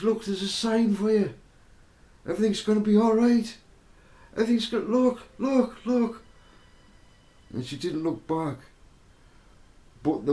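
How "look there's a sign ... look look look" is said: high, loud call across the riverbank; a man shouting reassurance to a distant woman by the river; shouting from a distance. Retold or imitated. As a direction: call out over forty yards to reach her. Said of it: "I'm shouting out a distance".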